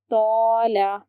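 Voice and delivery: तौला with a full, heavy "tau", mouth filled. Shown as a demonstration. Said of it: "इसे पढ़ते वक्त देखिए मुंह भर जाता है".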